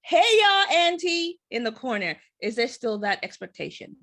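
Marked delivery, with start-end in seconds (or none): 0.0-1.4 high, loud folksy auntie greeting; hammed-up urban auntie. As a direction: call out like the sassy auntie in the corner. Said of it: "they're supposed to be the".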